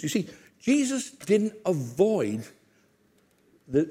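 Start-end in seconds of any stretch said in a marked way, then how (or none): none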